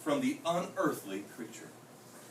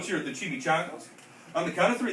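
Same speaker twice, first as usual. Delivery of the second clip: ordinary delivery, nothing marked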